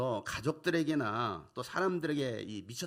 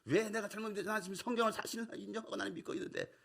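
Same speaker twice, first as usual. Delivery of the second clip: heated retort; 8 semitones higher, faster than usual. Retold or imitated. His own retort in the argument.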